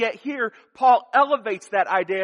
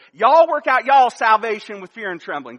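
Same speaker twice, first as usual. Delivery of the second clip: joking Southern twang; Southern accent; 7 dB louder than usual. Pretend. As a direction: say it like a Southern preacher, "Y'all" up front. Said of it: "he had that Southern accent".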